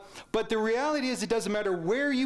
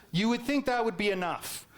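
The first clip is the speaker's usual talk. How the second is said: ordinary talk, nothing marked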